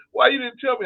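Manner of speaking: high, loud mock wife; shrill-pitched complaint in her voice; imitating his wife. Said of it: "My wife was like".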